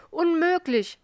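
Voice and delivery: high, loud cry of disbelief; loud shocked shout. A narrator's reading. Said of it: "schrie er".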